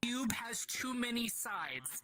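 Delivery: high, slow, strained storyteller; rising tense narration. Acted. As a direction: let the voice climb with dread. GM narrating overwhelming vision.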